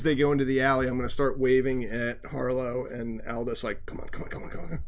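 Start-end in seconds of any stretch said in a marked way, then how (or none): 3.9-4.8 hushed, beckoning repetition; urgent whisper. Acted. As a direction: whisper them along while sneaking. Beckoning companions while sneaking past watch.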